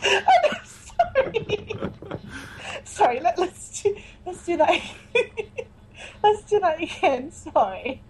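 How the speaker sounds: high, squeaky apology through laughter; helpless giggling. Real, not acted. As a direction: try to get words out while cracking up. Corpsing, asking for a retake.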